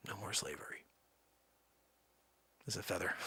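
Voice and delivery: soft whispered aside; hushed whisper. Acted. Lincoln's past self confiding, whispering. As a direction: breathe it out as a secret.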